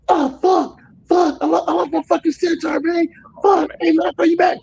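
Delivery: high, fast panicked cursing on the phone. Retold or imitated. Trucker on phone with friend as tire blows, panicked.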